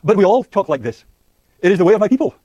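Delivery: emphatic defensive declaration. Acted. Language users defending their custom against criticism, loud defensive declaration. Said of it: "people typically defend their use of language".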